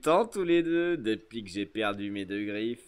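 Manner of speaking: low pirate voice, lightly put on; old pirate companion. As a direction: reminisce as a menacing old shipmate.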